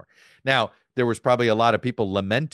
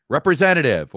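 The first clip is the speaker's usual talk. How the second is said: calling out louder and higher, like to a machine; mock shout at a phone menu; 6 semitones higher, 6 dB louder than usual. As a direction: shout the word at the automated line. Yelling at automated phone line.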